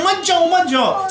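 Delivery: high, drawn-out acted plea; urgent warning call. Krishna warning his friends off the serpent, urgent warning call.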